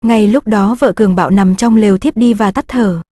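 usual speaking voice

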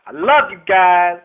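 slow, loud, happy farewell shout; loud outro shout. Real, not acted. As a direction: call it out to all the viewers.

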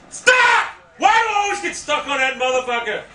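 explode, then rattle off the complaint; exasperated yell. Real, not acted. Gamer stuck on the map, exasperated shouting.

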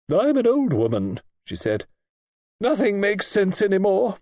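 put-on high, fretful old-lady complaint; weary old lady. A narrator's reading. Old lady complaining to Audrey that nothing makes sense. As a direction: give up with a grand sigh of age.

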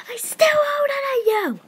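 loud, high indignant shout; furious sibling shout; shouting back. Acted. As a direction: yell it at the sister. Sister argument.

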